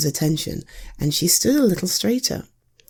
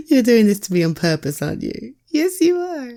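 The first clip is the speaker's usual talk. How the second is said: plain reading voice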